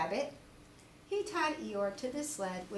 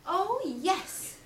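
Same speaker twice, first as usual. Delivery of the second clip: cried Christopher Robin; 8 semitones higher, 5 dB louder than usual. Acted. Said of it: "cried Christopher Robin".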